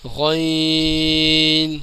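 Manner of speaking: غین heavy, very long, closing on n; full and thick. Shown as a demonstration. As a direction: stretch a thick throaty غین slowly. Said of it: "غین موٹا پڑھا جاتا ہے".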